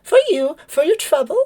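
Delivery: high, sweet chirp offering a treat; high sweet bird voice; kindly bird lady. Acted. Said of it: "and she would say".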